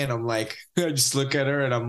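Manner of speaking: ordinary talk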